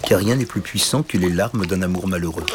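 ordinary delivery

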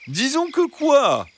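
high, drawn-out fox voice pressing for an answer; insistent fox. Acted. Fox pressing the stammering hens, insistent. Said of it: "insista le renard".

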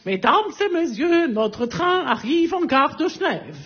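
annonce plus haute et lente de contrôleur de train; voix de contrôleur. Retold or imitated. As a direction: imiter l'annonce au micro du contrôleur. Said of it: "La voix du contrôleur". (le contrôleur annonce l'arrivée aux passagers, voix d'annonce haute et lente)

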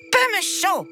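put-on high, haughty child voice; haughty little prince. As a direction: toss off "Peu me chaud" like a spoilt royal.

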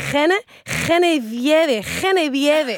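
"Geneviève" sounded out slowly, with a Spanish R; struggling pronunciation. Acted. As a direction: labour slowly over "Geneviève", Spanish accent. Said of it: "que c'est dur". (« Geneviève » articulé lentement, accent espagnol)

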